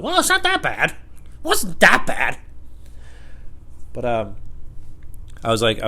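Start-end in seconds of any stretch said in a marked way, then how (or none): 0.0-2.4 high, put-on voice brushing it off; squeaky mock shrug; high and dismissive; mock exasperated voice. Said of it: "you're like".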